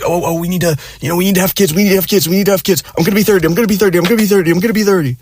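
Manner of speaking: high, rushed, whiny repeating; mocking the girlfriend's baby panic, high and frantic. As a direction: gabble it up high like a panicking nag. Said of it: "she just kept saying it over and over".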